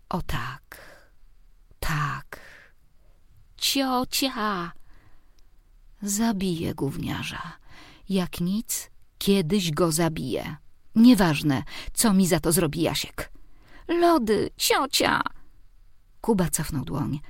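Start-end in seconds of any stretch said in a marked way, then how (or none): 0.1-2.4 whispered, dreamy sigh; breathy whisper. 3.6-4.7 put-on high kid's shout for auntie; a little boy yanking auntie back; child calling. 13.9-15.2 put-on high whiny kid demanding ice cream; a small boy pestering his aunt; child voice.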